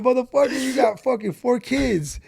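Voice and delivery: a furious father chewing out his son; angry dad. Retold or imitated. Dad yelling at his son over the new Corvette, loud and outraged. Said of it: "He's like".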